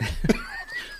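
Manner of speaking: high squealing laugh. Real, not acted. Laughs at imagined scene.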